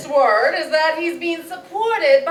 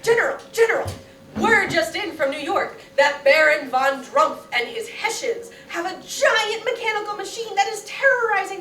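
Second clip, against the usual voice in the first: messenger voice. Acted. Messenger reports to the general.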